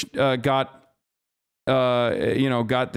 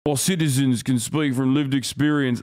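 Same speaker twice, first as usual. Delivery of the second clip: lightly mocking British accent; a hint of a British spokesman. Streamer reading the statement in a mild British accent. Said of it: "British accent".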